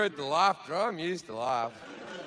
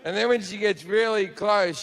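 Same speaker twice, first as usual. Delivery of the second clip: normal talking voice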